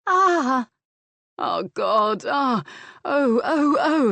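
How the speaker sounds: rising, loud sobbing oh-cries; sobbing climax cries. A narrator's reading. Man cresting after his lover, crying out in loud sobs. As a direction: let it spill out in sobs. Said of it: "he sobbed".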